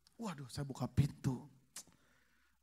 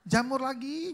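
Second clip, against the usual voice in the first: "Jamur lagi" pitched high and louder with a rise on "lagi"; rising fed-up "Jamur lagi"; exasperated complaint; 8 semitones higher, 6 dB louder, slower than usual. Retold or imitated. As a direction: whine "Jamur lagi" upward like a fed-up husband. Husband complains, same phrase repeated.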